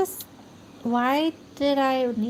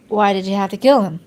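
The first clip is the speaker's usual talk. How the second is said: pressing interrogation; 2 semitones lower, 9 dB louder, faster than usual. Acted. Detective presses hard.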